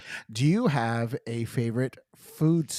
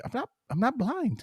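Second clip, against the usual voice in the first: mock indignant; 11 semitones higher than usual. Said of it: "I'm just like".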